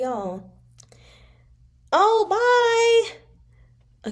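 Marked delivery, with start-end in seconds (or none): 1.9-4.1 high, bright goodbye call; cheery bye. Real, not acted. Reader calls goodbye to someone leaving, high and cheery. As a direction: sing out a farewell.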